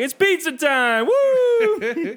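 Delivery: loud, high, drawn-out whoop; gleeful prank shout. Acted. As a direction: shout the punchline like a hyped-up prankster. Punchline of imagined prank call.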